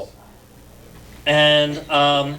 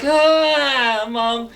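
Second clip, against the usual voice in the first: embarrassed protest; 6 semitones higher, 4 dB louder than usual. Retold or imitated. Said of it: "And I'm like".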